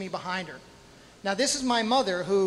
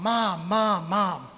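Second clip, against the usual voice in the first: mild mock yell for Mom; half-voiced re-enacted call; retold yell; much slower than usual. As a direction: imitate calling for your mother, without full volume. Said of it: "I'm yelling for my mom".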